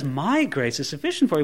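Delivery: emphasize My, then run lightly through the rest; low, quick line led by MY; stress on MY. Acted. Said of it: "the Lord God in heaven says".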